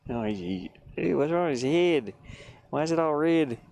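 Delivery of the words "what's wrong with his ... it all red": ordinary talk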